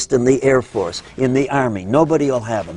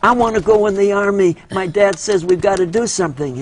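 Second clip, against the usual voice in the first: put-on eager kid; 8 semitones higher than usual. Boy pleading with the objector for his draft letter, high and eager. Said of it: "He said".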